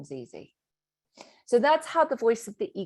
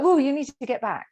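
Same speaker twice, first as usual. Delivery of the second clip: alarmed inner voice; 7 semitones higher, 7 dB louder than usual. Retold or imitated. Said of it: "that voice in your head will be like".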